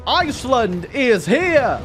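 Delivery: high, slow triumphant proclamation; hammed-up hero announcing an arrival, words stretched; mock heroic. Iceland arrives to save its Turkish allies, mock heroic.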